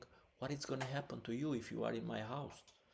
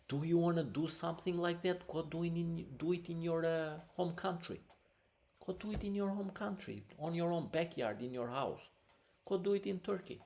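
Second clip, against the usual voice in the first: higher, raised voice, fast and furious; pitched-up fury against the student; heated angry rant; 8 semitones higher than usual. Real, not acted. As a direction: scold her hard to go home.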